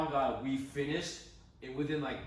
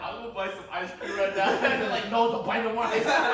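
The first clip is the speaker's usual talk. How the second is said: laughing; 9 semitones higher, 11 dB louder than usual. Retold or imitated. Crying promise, parents refuse.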